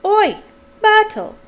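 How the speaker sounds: loud, high call-out; bellow. A narrator's reading. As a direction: bellow for someone across the room. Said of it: "took a deep breath and bellowed".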